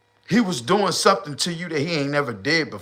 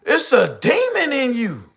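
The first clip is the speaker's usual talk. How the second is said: quoting her; 10 semitones higher than usual. Retold or imitated. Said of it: "She said".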